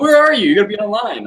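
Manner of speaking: high, loud impatient yell; Sarah yelling. Retold or imitated. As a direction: shout it like a demanding friend. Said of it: "Sarah's, like, yelling at me".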